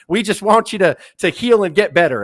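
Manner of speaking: high, loud mock concern; mock patronizing. Imitating people coddling the injured wrestler, patronizing. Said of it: "he hated to be patronized".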